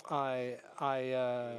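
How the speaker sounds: ordinary delivery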